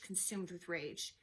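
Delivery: plain reading voice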